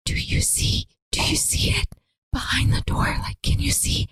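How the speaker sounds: imitating a scared, unvoiced whisper; terrified whisper. Joey King begging Nancy to look behind the door, frightened whisper. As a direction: whisper it urgently, as if something is there.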